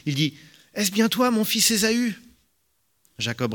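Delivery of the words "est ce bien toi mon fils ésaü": doubting question. A narrator's reading. Blind Isaac doubts his son.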